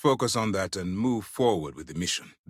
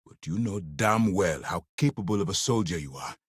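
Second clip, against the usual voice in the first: ordinary talk, nothing marked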